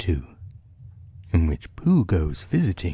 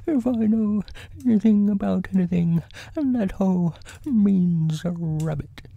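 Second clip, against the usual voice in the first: high, simple bear voice; musing storybook bear; 12 semitones higher than usual. Acted. Bear working out what the hole means, high character voice. Voices Pooh reasoning aloud.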